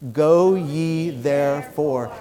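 very slow, measured scripture words; leading recitation. Shown as a demonstration. Preacher leading congregation saying the verse along, slow and measured.

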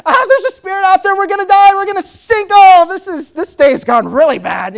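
high, loud terrified yelling; screaming in fear. Retold or imitated. Disciples screaming at a spirit on the water, terrified. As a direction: shriek like you've seen a ghost on the sea. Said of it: "yelling and screaming".

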